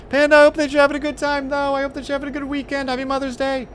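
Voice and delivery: high, fast cheerful wishes; cheery high greeting. Real, not acted. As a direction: chirp it out brightly.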